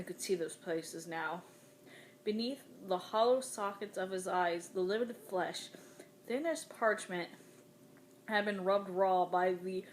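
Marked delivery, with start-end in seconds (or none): none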